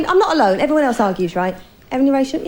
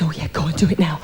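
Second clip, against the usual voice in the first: whispered, lower, keen husband; eager whisper; 8 semitones lower, 4 dB quieter than usual. Retold or imitated. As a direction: breathe it out, eager and hushed.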